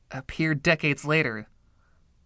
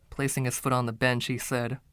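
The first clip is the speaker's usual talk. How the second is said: plain reading voice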